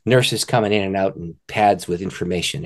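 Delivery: ordinary talk, nothing marked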